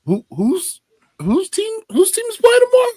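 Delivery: squeaky high, fast, puzzled asking; hammed-up confused fan; mock clueless question. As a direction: play dumb in a sky-high squeak. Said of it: "I said".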